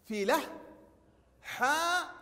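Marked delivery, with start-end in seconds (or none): 1.4-2.1 حاء with a long, high-pitched open aa; long alif vowel. Shown as a demonstration. Teacher showing pupils the open letter, drawn-out vowel. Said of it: "نلاحظ النطق مرة أخرى".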